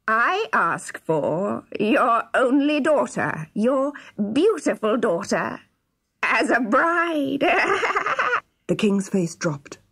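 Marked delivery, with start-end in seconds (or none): 0.1-7.4 slow, high, gloating villain voice; sneering dwarf. A narrator's reading. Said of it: "sneered the dwarf". 7.5-8.4 high-pitched gloating dwarf laugh; evil cackle. Acted. Dwarf gloats over his demand.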